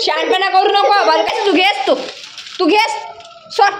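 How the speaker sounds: mother scolding her naughty son. Real, not acted. Mother scolding disobedient child.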